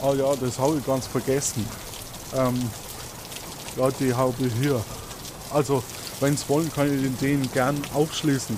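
put-on helpful old-timer handing over the keys; Toni obliging. Toni offers to unlock the doors for the investigators, lightly Bavarian and obliging. GM holds Toni's voice.

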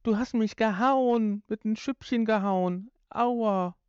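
put-on high, slow childish whine; kindergarten whine. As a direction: whimper like a hurt toddler. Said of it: "ungefähr so kindergartenmäßig".

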